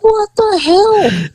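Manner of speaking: high, slow, put-on voice of baffled disbelief; high drawn-out what the hell. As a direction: stretch it out, totally baffled. Own baffled reaction retold.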